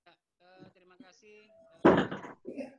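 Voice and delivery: barely audible, far from the mic; faint voice. Real, not acted. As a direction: speak faintly as over a weak microphone. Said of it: "Suaranya kecil sekali".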